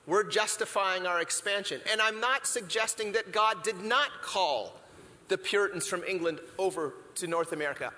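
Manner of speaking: ordinary delivery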